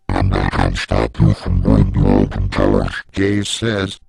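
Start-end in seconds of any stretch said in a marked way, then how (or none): 0.1-2.9 much lower and louder, a booming monster voice; monstrous dragon lady. Acted. The Dragon Lady teacher berates a student in a deep, booming monster voice. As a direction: growl it down low like a beast scolding a pupil. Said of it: "Then she goes".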